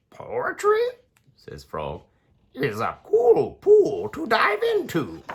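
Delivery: chirpy little frog; high frog voice. Acted. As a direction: croak it up high. Frog character voice.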